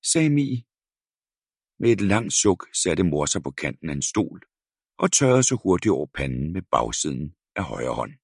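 ordinary delivery, nothing marked

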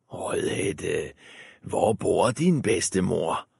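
soft sly wolf. A narrator's reading. Wolf asks the girl, soft and sly. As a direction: lure her gently.

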